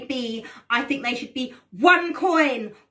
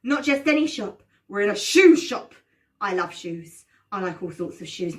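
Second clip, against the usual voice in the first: put-on kids party magician; 3 semitones lower, 3 dB louder than usual. Storytelling class host voice.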